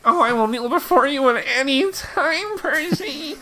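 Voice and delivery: fawning servant. Acted. Character fawning over new master.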